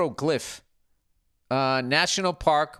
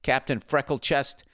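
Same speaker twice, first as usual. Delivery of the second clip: ordinary talk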